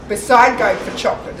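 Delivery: ordinary talk, nothing marked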